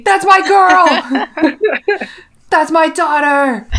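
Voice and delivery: slow, high, loud gush of pride; proud gushing. Acted. Fan gushing over Ginny like a proud mom. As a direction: drag it out like a doting parent. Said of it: "proud over Ginny".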